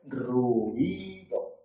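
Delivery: RUIDO drawn out as ru-i-do in three beats; split into syllables. Shown as a demonstration. Teacher reads Fray Luis's line with a hiatus, drawn-out ru-i-do. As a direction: say "ruido" slowly with u and i in separate syllables. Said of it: "entonces tendrás que decir".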